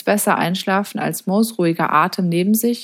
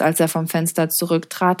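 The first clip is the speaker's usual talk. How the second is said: ordinary delivery, nothing marked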